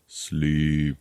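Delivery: low, drawn-out subliminal broadcast; droning hypnotic voice. Retold or imitated. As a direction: drone it out like a hidden command. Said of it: "this voice going".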